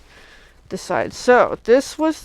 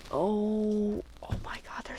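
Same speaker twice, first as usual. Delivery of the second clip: slow, awed whisper rising on cute; 10 dB quieter, slower than usual. Real, not acted. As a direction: whisper it like you can't contain it. Gushing over cute kitty dies.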